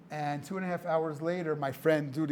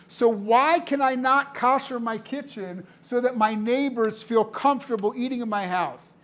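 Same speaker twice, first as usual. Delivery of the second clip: impassioned plea; 7 semitones higher, 7 dB louder than usual. Retold or imitated. Retelling a neighbor's plea for her kitchen, impassioned and louder. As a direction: push each word out as if pleading.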